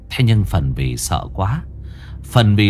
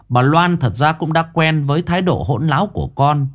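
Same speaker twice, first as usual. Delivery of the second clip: plain reading voice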